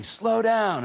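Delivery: high, loud mock yell; retold scream. Fiancée on the motorcycle yelling at him to slow, mock high yell. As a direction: yell it at the speeding driver, half-hearted. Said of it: "And she's screaming".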